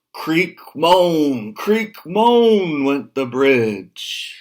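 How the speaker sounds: loud, high, slow creaking and moaning; big bridge creaking.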